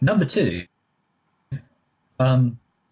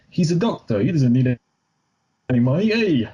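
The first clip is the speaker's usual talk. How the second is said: high, hammed-up character accent; put-on accent pitched up, rising on the last words; exaggerated accented mimicry, higher than usual; Johnny Zucker accent; 4 semitones higher than usual. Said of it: "why is everyone that fucking Johnny Zucker".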